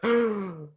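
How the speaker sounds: high, sharp mock gasp; dramatic gasp. Imitating viewers hit by movie drama, theatrical gasp. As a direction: gasp as if scandalized. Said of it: "just enough drama for the gays to be like".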